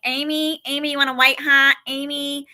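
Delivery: mimicking a Western New York mom calling, Western New York accent; nasal Western New York accent. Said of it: "the accent in that area is very nasal".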